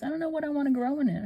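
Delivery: high, fast daughter voice; quoting daughter. Retold or imitated. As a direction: rattle it off high like her daughter. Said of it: "She goes".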